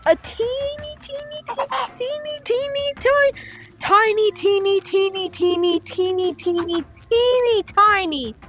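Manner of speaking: high, playful squeaky-small singsong. Real, not acted. Playfully stretches out how tiny it is.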